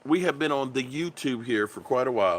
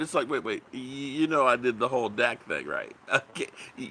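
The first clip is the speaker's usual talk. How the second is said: ordinary talk, nothing marked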